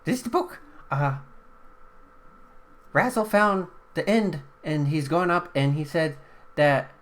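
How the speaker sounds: very high squeaky book voice relaying a message. Acted. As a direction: chirp the message in a tiny high voice. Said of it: "squeaky voice".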